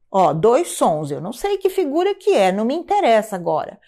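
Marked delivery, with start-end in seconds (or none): none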